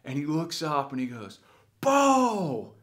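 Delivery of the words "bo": high, loud call of recognition; delighted greeting. Retold or imitated. Old coach looks up at former player, delighted shout. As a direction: burst out with the name, high and loud.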